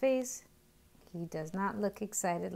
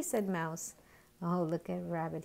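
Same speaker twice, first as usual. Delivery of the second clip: plain reading voice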